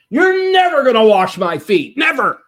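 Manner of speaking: loud, high protest; blurting out. Retold or imitated. Peter refusing Jesus at the basin, blurting it loudly. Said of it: "Peter just blurts out".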